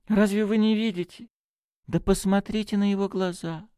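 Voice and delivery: high-pitched, urgent priest; excited. A narrator's reading. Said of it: "взволнованно вскричал он". (взволнованно, высоким голосом)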